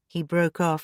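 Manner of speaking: ordinary delivery, nothing marked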